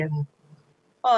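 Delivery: ordinary talk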